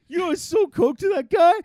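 high, loud bragging caricature; imagined listener. Pretend. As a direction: boast like the thrilled dealer. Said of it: "He's listening too, going".

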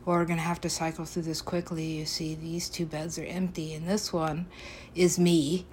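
ordinary delivery

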